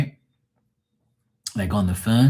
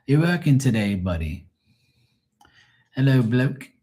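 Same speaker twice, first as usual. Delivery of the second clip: hammed-up British small talk; mock British; 3 semitones higher than usual. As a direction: put on a silly British accent. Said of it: "British is like".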